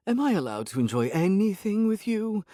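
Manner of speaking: complaining. A narrator's reading. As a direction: grumble like an annoyed cat. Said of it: "she complained".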